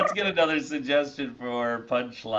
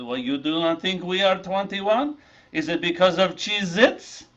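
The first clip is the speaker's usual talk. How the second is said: put-on a light French accent, a little louder; lightly put-on French, offended; French teen accent; 6 semitones higher, 4 dB louder than usual. French teenage snacks challenging the waiter, light French accent. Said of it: "two French teenage snacks".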